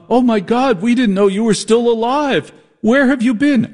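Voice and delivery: high-pitched, hammed-up shock; mock dramatic astonishment. Parody of a serious drama's reunion, mock astonishment. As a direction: overplay the astonished reunion cry.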